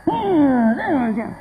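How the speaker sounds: put-on wordless sulk; mock offended huff. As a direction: imitate someone huffing off offended. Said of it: "se fue enojado".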